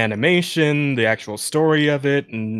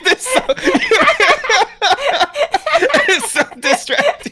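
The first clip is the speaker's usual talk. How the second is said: breaking up laughing mid-sentence; can't stop laughing; laughing hard; 5 dB louder than usual. Real, not acted. Laughing at distraction.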